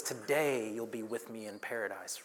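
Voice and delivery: low, hushed promise; quiet reassurance. Retold or imitated. Jesus to the criminal on the cross, quiet reassurance. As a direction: say it softly, pitched well down.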